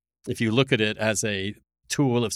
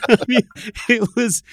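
words squeezed out through laughter, pitched up; laughing; 9 semitones higher than usual. Real, not acted. Host amused by his own weed joke, laughing through words.

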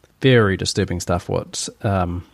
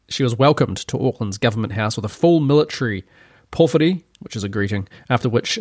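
ordinary delivery

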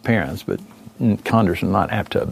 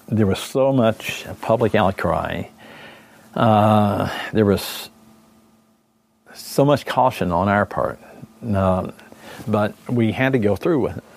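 choked up, halting with long pauses; trying to hold it together, tearing up; emotional. Real, not acted. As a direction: fight back tears while recalling it. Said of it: "that's why I'm emotional about it".

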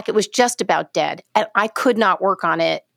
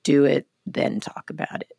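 put-on much lower, grave old sage's voice; Will Sampson voice; 7 semitones lower, 4 dB quieter than usual. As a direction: deliver it like a solemn elder. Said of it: "used to say in his great, Will Sampson".

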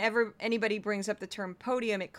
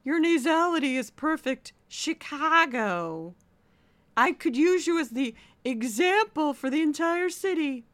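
high, loud, drawn-out theatrical diction; imitated voice teacher; 6 semitones higher, 8 dB louder, slower than usual. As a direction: proclaim it like an overblown voice coach. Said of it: "she would say".

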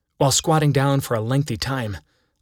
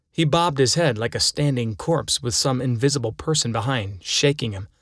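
ordinary delivery

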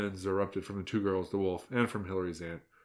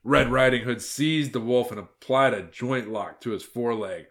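ordinary delivery, nothing marked

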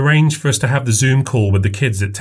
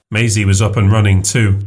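usual speaking voice

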